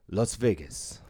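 "Las Vegas" with a Spanish accent. Shown as a demonstration. Joking about saying place names right, Spanish accent. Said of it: "that's why I'm always like".

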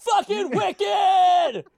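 high, slow screamed outburst; hammed-up furious shriek; mock scream. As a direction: belt it like a raging cook. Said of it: "You gotta stop screaming like that".

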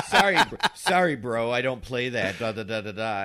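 mock condescending brush-off; dismissive retold scolding, breaking into laughter. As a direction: imitate a guy chastising a friend. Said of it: "gives me sort of this condescending, like, chastising me".

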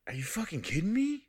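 high, pained disbelief; incredulous. Retold or imitated. Player told to bury the body, incredulous. Retold disbelief at game's next task.

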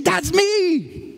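high, exclaimed announcement; crying out. Retold or imitated. Jesus claiming the prophecy for himself, crying out. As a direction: proclaim it high, as the answer to their prayer. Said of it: "it says that he cries out".

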